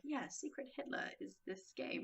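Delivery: hushed, easy delivery; smooth-voiced host. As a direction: imitate a laid-back smooth host quietly. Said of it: "very smooth-voiced Canadian guy".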